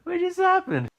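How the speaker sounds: stunned, high-pitched what-just-happened; baffled. Retold or imitated. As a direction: squeak it out like you can't believe what you heard. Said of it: "I'm like".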